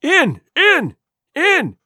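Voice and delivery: high, loud, repeated command; furious shout. Acted. Blind man urges his slow gang inside, high furious shout. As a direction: shout each word separately, eager and enraged. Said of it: "he shouted".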